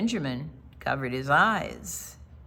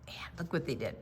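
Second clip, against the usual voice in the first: whispered aside; soft unvoiced invitation to look; 4 semitones lower, 10 dB quieter, faster than usual. Real, not acted. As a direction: share it in a whisper.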